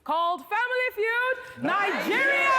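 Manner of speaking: high, bright game show welcome; excited host greeting. Acted. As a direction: greet the audience with big energy.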